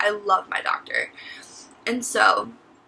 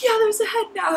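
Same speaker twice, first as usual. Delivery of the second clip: high excited aunt voice; a touch of an amazed aunt; 12 semitones higher, 4 dB louder than usual. Retold or imitated. Aunt confirms the head.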